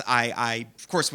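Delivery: normal talking voice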